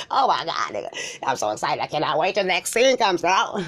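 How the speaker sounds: ordinary delivery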